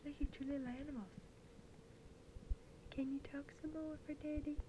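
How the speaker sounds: hushed whisper to a newborn. Real, not acted. Parent whispering to baby.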